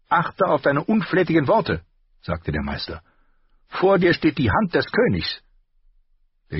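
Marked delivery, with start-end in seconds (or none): 0.1-1.8 higher, sharper armourer voice; the master's voice. A narrator's reading. Armourer rebuking his apprentice, higher voice. 3.7-5.4 higher, sharper armourer voice; the master's voice. A narrator's reading. Armourer rebuking his apprentice, higher voice.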